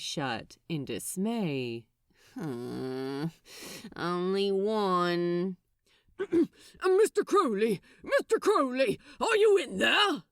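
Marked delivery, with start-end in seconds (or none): 2.3-5.6 lower, slow, dismayed demon; a sinking sigh of a demon who knows who's knocking; Crowley, dismayed. Acted. 6.8-10.2 loud, higher, pompous upper-class caller; pompous Ismay. Acted. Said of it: "This pompous, intrusive, upper-class voice".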